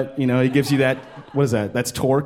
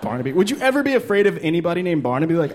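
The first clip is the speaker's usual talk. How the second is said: usual speaking voice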